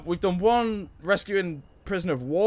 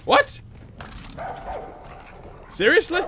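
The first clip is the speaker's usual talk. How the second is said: shocked disbelief; 14 semitones higher than usual. Real, not acted. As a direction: react in total disbelief. Shocked at the game.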